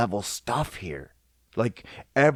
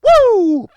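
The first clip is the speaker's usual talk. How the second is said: loud, very high joyful whoop; excited woo, shouted; happy whoop; 13 dB louder than usual. Shown as a demonstration. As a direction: let out a cheering woo. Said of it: "you can say it in happiness".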